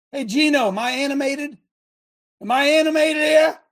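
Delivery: showing off how animated you are. Shown as a demonstration. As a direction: demand it with mounting energy. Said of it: "To animate. Do I animate?".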